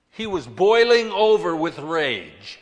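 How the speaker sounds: loud, heated emphasis; forceful preaching. A narrator's reading. Preacher describing Jonah's rage to the congregation, forceful. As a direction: let the words boil over loudly.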